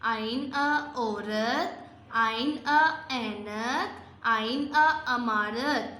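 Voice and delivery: very slow, every sound stretched; slow read-along. Shown as a demonstration. Teacher repeating the drill with the children, very slow and drawn out. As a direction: draw out each syllable for the class to echo. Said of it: "फिर मेरे साथ मिलके एक दफ़ा दोबारा पढ़ें".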